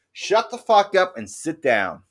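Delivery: retold scolding. Scolding a rowdy friend.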